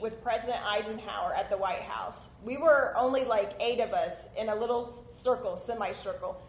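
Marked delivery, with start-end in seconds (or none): none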